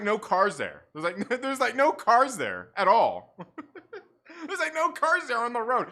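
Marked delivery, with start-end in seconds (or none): 1.6-5.9 laughing through it in disbelief; can't stop laughing at it. Real, not acted.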